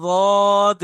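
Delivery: रॉद said with a thick, heavy Arabic d; demo of Arabic letter. Shown as a demonstration. Teacher showing students the distinct letter sound, loud. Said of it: "ये sound ही अलग है बिल्कुल".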